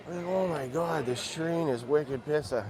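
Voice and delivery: light mock Boston accent, slowed down; a hint of Southie Boston, drawn out. Joking to stream viewers on the train, light Boston accent. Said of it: "do my Boston accent".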